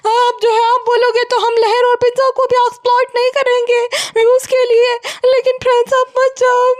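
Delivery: high-pitched, hammed-up wailing plea; mock tearful vlogger. Vlogger begging followers not to leave, mock wailing. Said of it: "जनाब और भैंसुआ पे दोनों ये Ninja technique आजमा रहे हैं".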